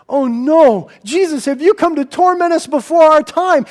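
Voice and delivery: panicked demons. Acted. Demons pleading with Jesus.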